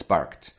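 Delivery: "barked" as one syllable, barkt; corrected "barked" with unvoiced T; correct T ending. Shown as a demonstration. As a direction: end "barked" on a crisp T. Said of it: "no, it's barked".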